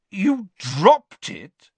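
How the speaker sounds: very slow, high, loud disbelief; disbelieving. A narrator's reading. Diggory repeating the boy's words, in disbelief. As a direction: stretch each word incredulously. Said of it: "repeated Mister Diggory in disbelief".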